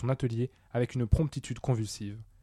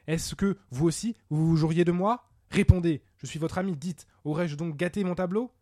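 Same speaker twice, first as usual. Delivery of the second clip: high-pitched, agitated pleading; wounded, insistent questioning; anxious demand; 6 semitones higher, 4 dB louder than usual. Acted. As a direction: press for an answer, voice raised in distress. Wounded painter demands an answer.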